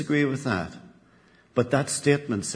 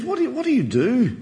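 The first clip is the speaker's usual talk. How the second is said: much higher, swooping curious question; lightly acted disbelief, pitched right up; astonished barman; 12 semitones higher, faster than usual. Barman questions the talking penguin, acting astonished.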